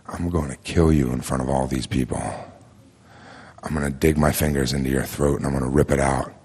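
low, softly spoken threat; retold threat, held down low and close; quiet menace. Storyteller threatening a man up close, quietly menacing.

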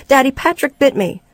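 put-on high-pitched child's complaint; tattling little boy. Bitten boy to his father, tattling little boy. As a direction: run to daddy and tell on your brother.

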